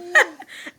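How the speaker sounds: a burst of high loud laughter; loud high cackle of laughter. Real, not acted. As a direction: laugh out at the memory.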